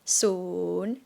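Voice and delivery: scoop soon down and up; Thai rising tone. Shown as a demonstration. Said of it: "rising tone".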